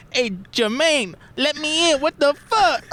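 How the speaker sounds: high, drawn-out mock yelling; squealing impression of an outraged woman locked out; imitating a woman neighbor. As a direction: holler in a very high put-on voice. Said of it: "this is just what they sounded like".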